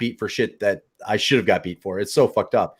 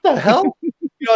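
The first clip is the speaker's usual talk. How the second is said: incredulous; 12 semitones higher, 5 dB louder than usual. Real, not acted. Laughing disbelief.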